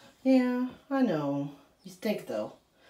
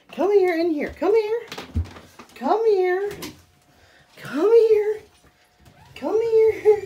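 high, louder coaxing call; 11 semitones higher, 3 dB louder, much slower than usual. Real, not acted. Owner calling her dog into the bath, high coaxing. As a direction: sweet-talk him in, pitched high.

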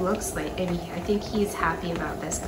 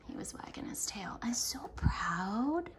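tearful whisper; 9 dB quieter than usual. Real, not acted. Owner moved to tears by her dog, whispering. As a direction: whisper it, choked up with pride.

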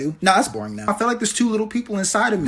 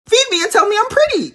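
much higher, louder pampered whine; whiny diva demand; 14 semitones higher, 7 dB louder than usual. Acted. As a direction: beg like a spoiled princess. Pregnant woman demanding pampering.

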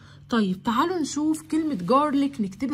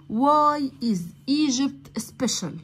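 slow reading; much slower than usual. Shown as a demonstration. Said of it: "teacher reading lesson title".

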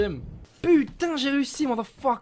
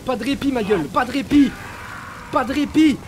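heated fight; 5 semitones higher, 6 dB louder than usual. Real, not acted. Fighting the boss.

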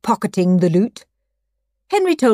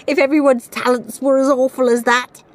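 higher, gleeful inner voice; 6 semitones higher, 3 dB louder than usual. A narrator's reading. Henry thinking about his weak rivals, gleeful. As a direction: gloat with raised pitch. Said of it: "thought Horrid Henry gleefully".

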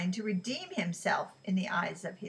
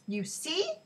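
exclaiming; 8 semitones higher than usual. A narrator's reading. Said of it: "exclaimed Israel".

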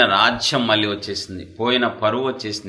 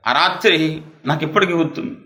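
ordinary talk, nothing marked

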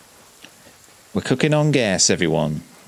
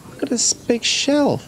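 much higher, wide-eyed tiny voice; squeaky kid voice. Acted. A little one showing off a shell in a squeaky voice. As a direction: delight over a shell like a small child. Voicing a small character in-game.

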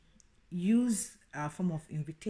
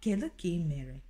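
normal talking voice

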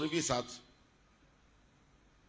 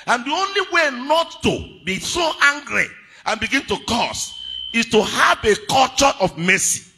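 raised, emphatic preacher's voice; forceful preaching; 7 semitones higher, 9 dB louder than usual. Acted. Preacher urging a culture of mercy on the congregation, forceful preaching. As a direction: drive the point home to the congregation.

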